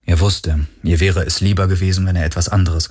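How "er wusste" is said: ordinary delivery, nothing marked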